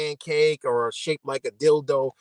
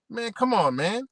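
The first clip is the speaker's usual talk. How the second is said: usual speaking voice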